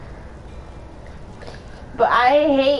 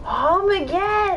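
put-on high, slow, slurred drunk voice; 7 semitones higher, slower than usual. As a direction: draw it out drunkenly. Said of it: "I was just doing drunk voice".

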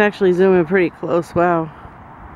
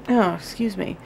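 ordinary talk, nothing marked

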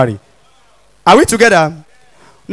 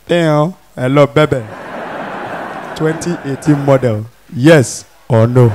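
cool low pickup line; 8 semitones lower, 4 dB quieter than usual. Acted. Igbo man in car, smooth.

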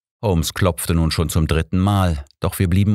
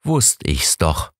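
slow, knowing "told you so"; knowing look; slower than usual. A narrator's reading. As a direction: drawl it out as if you knew it all along. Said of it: "der so viel sagte wie".

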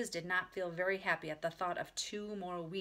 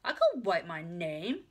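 put-on loud, slow child claim; boastful little girl; 6 dB louder, slower than usual. A narrator's reading. Ramona claims she can write, slow and loud. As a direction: declare it like a small child. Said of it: "said Ramona".

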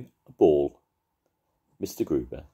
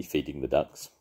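ordinary delivery

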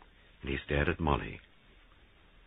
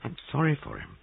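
said slowly; 10 semitones higher than usual. A narrator's reading. Molly admitting pity for Christopher, slowly. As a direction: offer it slowly and gently. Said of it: "Molly said slowly".

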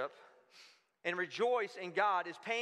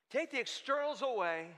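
normal talking voice

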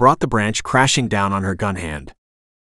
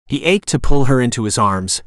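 ordinary delivery, nothing marked

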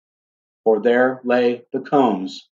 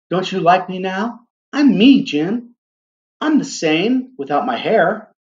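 anxious Della; 7 semitones higher than usual. A narrator's reading. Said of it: "said Della".

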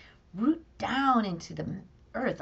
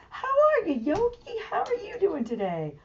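high squeaky greeting; 9 semitones higher than usual. Acted. Talking to the puppet.

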